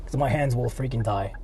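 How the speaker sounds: ordinary delivery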